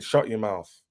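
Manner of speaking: normal talking voice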